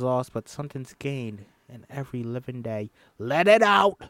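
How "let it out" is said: loud, high push to let it out; urging coach. Acted. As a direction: shout encouragement over the music. Singing coach urges Ruby.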